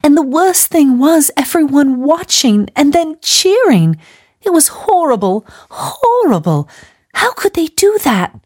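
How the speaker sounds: put-on high, tearful wail of a shocked young woman; wailing girl. A narrator's reading. Gladys wailing to Missus Lawson about the execution, high tearful wail. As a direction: cry it out, still in shock from what she saw. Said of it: "wailed Gladys".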